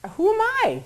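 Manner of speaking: high, drawn-out retold disbelief; incredulous self-doubt. Protesting that nobody will listen to her, incredulous. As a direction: question your own standing, slow and high.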